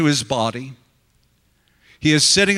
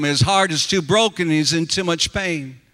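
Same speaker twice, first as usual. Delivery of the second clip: ordinary delivery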